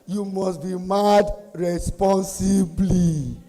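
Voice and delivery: slow, drawn-out proclamation; emphatic slogan. Real, not acted. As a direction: stretch every word for the crowd.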